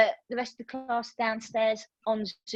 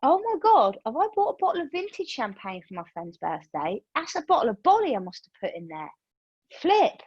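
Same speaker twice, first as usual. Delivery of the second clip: shrieking high gasp of shock, then fading to worried muttering; mock shock; 6 semitones higher than usual. Yoga teacher retelling shock at the till price. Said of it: "I'm going".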